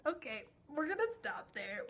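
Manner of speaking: usual speaking voice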